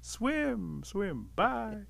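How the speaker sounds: high-pitched call at normal volume; high, falling sing-out; fading farewell call. A narrator's reading. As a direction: call it after someone drifting away, easing off. Rabbit sees Otter swim away.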